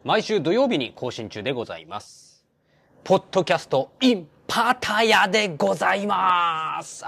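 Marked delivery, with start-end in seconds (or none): 3.0-7.1 announcer call-out. Acted. Show title called out.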